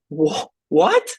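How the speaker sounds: loud, stunned double take; stunned what. Retold or imitated. Telling how the checks kept coming, stunned. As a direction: blurt it out in disbelief. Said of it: "Like, just mind blown".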